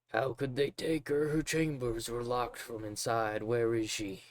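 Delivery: a broken father rambling in grief; distraught father. Acted. Voices the drunk father.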